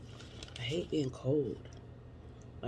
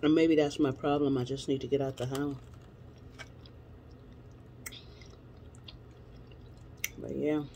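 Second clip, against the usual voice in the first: chewing while talking; speaking between bites; mouth full. Real, not acted. Seen 1: Bite taken at -2.0s; jaw and lips still working during speech.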